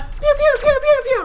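high, loud pew-pew noises; rapid cartoon laser sounds; imitated laser zaps. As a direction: fire off playful mock laser shots.

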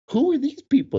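high-pitched incredulous question; mock astonishment. Men staring at the wives, high bewildered demand. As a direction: ask it as if utterly baffled.

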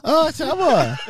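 high, laughing fake Japanese accent; mock Japanese accent. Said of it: "give us a little Japanese".

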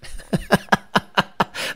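breathy staccato laughing; real amused cackling; laughter. Real, not acted. Host laughing at a remembered video, breathy laughter.

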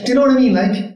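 Cork lilt, starting high; involuntary Cork accent. Retold or imitated. Retrying the Cork catchphrase, still in a Cork accent. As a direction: try for your own accent, slip into Cork. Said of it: "tried to say that in my own accent".

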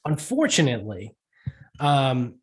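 ordinary talk, nothing marked

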